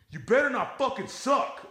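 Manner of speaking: high put-on voice of a heckling friend; mocking friend. Comic retelling a friend warning the newbie, high mocking voice. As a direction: squeak it out like a dumb buddy. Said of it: "one of his friends goes".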